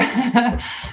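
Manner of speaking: short amused laugh at herself; light laugh. Real, not acted. Streamer laughing at her own story about her eyes, a short light laugh.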